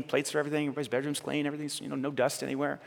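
ordinary talk, nothing marked

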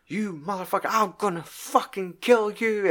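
imitating a furious threat. Arrested Jack phoning Eddie, mock furious threat. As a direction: ham up a raging prisoner's threat.

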